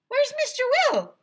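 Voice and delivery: put-on high, loud kid voices; kids asking. Kids ask the new ice cream man, high loud. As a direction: ask it like eager children. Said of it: "we asked him".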